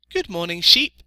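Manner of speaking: high, loud piglet voice; little piglet, greeting. A narrator's reading. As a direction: pipe up a greeting as a small pig.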